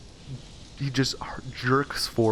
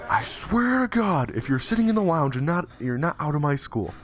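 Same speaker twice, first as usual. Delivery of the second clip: mock-furious shout from the next room; principal yelling; 7 semitones higher, 3 dB louder than usual. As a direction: yell threats like a furious principal. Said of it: "hears the principal yell".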